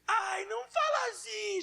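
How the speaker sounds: pleading cry, pitched high and slow; a child begging through tears. Retold or imitated. Son begging his father to stop, crying. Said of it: "Já começou a chorar".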